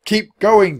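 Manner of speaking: put-on high, loud boy's urging; urgent shout. A narrator's reading. Jack to Annie while chased, shouting. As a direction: shout encouragement on the run. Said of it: "Jack shouted to Annie".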